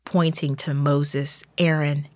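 plain reading voice

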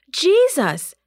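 high, loud call of a name; frightened disciple shouting for Jesus in the storm; shouted name. A narrator's reading. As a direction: call out in panic over the storm. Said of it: "he shouted".

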